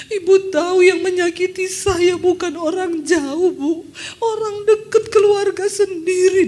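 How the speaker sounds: imitating a woman sobbing as she opens up; tearful confession. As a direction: pour out the hurt while crying. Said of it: "Dia nangis Saudara".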